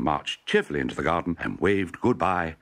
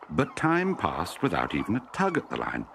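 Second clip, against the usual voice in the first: ordinary delivery, nothing marked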